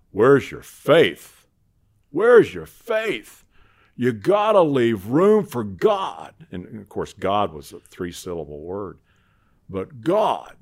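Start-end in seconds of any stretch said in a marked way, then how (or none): 0.1-6.3 loud, slow, booming preacher voice; big deep voice. Retold or imitated. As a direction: boom it out slowly like a gentle giant. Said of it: "big, deep voice".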